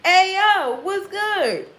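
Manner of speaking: high loud street holler; yelling from the window. Pretend. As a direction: catcall him casually. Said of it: "motherfuckers yelling from the window".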